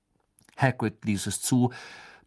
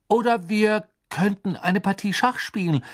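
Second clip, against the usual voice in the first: high, eager counter-offer; hasty suggestion; 10 semitones higher, 4 dB louder than usual. A narrator's reading. Ron hastily offers chess to avoid a quarrel. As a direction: blurt an alternative, pitch raised. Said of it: "sagte er hastig".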